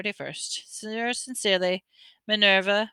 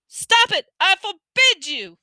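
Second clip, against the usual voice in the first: shrieking panic, much higher and louder; panicked yell; 12 semitones higher, 7 dB louder, slower than usual. A narrator's reading. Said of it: "yelled Uncle Vernon in panic".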